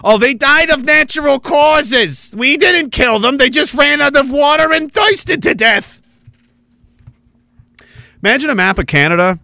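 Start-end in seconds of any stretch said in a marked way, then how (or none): 0.1-6.0 higher, slow mock spokesman voice; drawn-out, high mock denial; mocking official excuse. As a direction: sneer like a smug official denying blame, slow and high. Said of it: "that's what they'll do".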